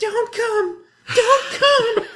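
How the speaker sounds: very high, loud, laughing squeal; game girl's cry. Retold or imitated. Quoting the House of the Dead girl, squealing high and loud. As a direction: shriek it like the panicked game girl. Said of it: "I laughed like a little fucking child".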